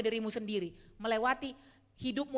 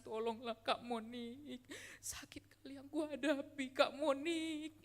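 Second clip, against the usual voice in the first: soft, slow imitation of someone crying and begging; hammed-up sobbing plea to a counselor; mock tearful plea; 7 dB quieter, much slower than usual. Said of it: "orang udah nangis-nangis". (tiruan memelas sambil menangis, pelan dan lambat)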